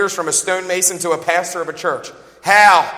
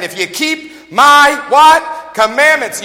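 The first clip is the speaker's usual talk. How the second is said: loud, higher, slow call-out; call to congregation; 8 semitones higher, 8 dB louder, much slower than usual. Real, not acted. Preacher drawing the word out of the crowd, loud. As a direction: stretch it and wait for them to answer.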